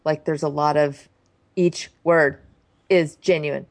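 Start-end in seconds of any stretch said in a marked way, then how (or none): 1.5-3.6 "each word is genuine" in separated staccato chunks; staccato imitation. Shown as a demonstration. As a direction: break up "each word is genuine" with pauses, mocking his staccato. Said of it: "do that sort of staccato".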